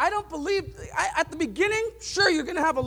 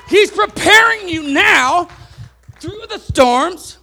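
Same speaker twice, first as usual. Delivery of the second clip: louder, driving the point home to the congregation; full-force preaching; 4 semitones higher, 12 dB louder than usual. Acted. Preacher warning the congregation about unsteady leaders, loud preaching. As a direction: preach it hard from the pulpit.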